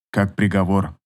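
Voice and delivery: plain reading voice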